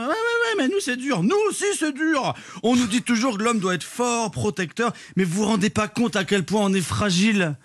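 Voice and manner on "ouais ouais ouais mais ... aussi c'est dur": high whiny mock complaint; mocking women's voice. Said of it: "les femmes, elles sont".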